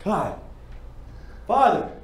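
anguished cry. Acted. Audition monologue, emotional outburst.

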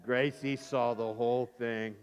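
low, slow deliberate narration; slow, weighty reveal. A narrator's reading. Storyteller repeats the reveal for effect, slow and weighty. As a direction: spell out the bad news slowly.

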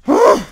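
a high, loud sighing ugh; exasperated groan. Real, not acted. A gamer groans loudly after missing the jump again. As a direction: let out a fed-up groan.

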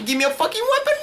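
very high-pitched, desperate squeal; frantic demand. Real, not acted. Player without a weapon in a fight, frantic. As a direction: shriek the demand in a panic.

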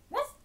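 short, sudden woof; imitated little woof; dog woof. A narrator's reading. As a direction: bark like a dog. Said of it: "said the dog".

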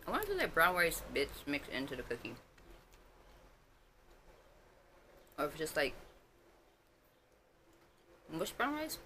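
talking with mouth full; speaking between bites; chewing. Real, not acted. Seen 4: eats cookie pieces, chewing with full mouth throughout.

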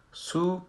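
"سو" as "soo", lips pushed forward and rounded; rounded-lip damma. Shown as a demonstration. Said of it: "لبای ما اینطور".

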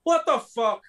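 high, loud mock outburst as [name]; imitating [name]. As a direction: yell it like a baffled rock star. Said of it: "And he went".

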